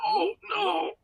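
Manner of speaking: high panicked begging; terrified pleading. Acted. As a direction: beg for your life, voice cracking. Captive begs for his life.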